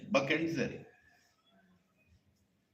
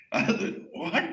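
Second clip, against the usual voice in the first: acted high laughter around an incredulous word; amused disbelief; 9 semitones higher than usual. Sage told off by a small boy, laughing incredulously. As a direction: burst out laughing in mock disbelief.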